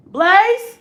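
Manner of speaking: loud, high call of a name. Real, not acted. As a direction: call into the next room. Calling a kid's name.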